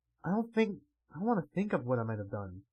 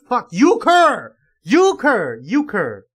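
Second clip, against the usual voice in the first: high shouted name, fading each time; frustrated yelling; 7 semitones higher, 15 dB louder, much slower than usual. Real, not acted. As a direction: yell the name out in exasperation. Player yells a character's name.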